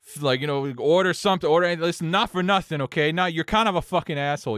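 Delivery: hammed-up bar argument; upset customer. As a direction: imitate a belligerent drunk telling someone off. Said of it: "he gets more upset".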